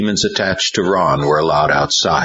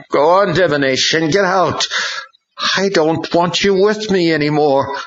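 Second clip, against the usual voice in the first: put-on determined boy; 13 semitones higher than usual. A narrator's reading. Ron ordering the demon out, higher. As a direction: send the demon away.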